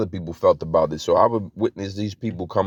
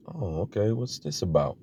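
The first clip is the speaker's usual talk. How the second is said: calming down; 8 dB quieter than usual. Retold or imitated. Two-faced passer-by coming closer, suddenly calm. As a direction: drop to a quiet, innocent-sounding murmur. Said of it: "start to calm down".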